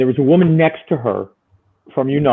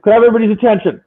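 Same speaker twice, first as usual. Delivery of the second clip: calling out; 9 semitones higher, 5 dB louder, much faster than usual. Retold or imitated. Calling out to store crowd.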